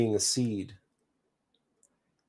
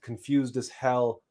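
ordinary delivery, nothing marked

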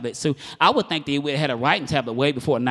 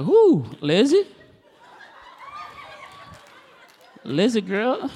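high, drawn-out appreciative call; playfully flirty husband; mock admiring; 13 semitones higher, 4 dB louder, slower than usual. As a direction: act out a smitten husband eyeing his wife. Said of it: "He thinking it 'cause he can't say it".

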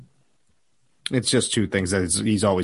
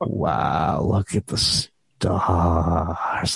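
mock awestruck; 7 semitones lower, much slower than usual. Friends awestruck under the night sky.

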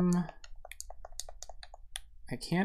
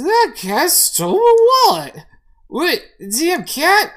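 put-on very high, loud drunken outcry; outraged drunk man; 10 dB louder, slower than usual. Drunk man yelling after the thieving cat, outraged. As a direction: holler after a thief, words stretched out.

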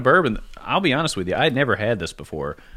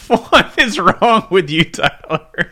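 slow, loud, laughing groan; laughing disbelief; 8 semitones higher, 8 dB louder, much slower than usual. Real, not acted. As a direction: drag it out in mock horror. Groaning at a bad joke.

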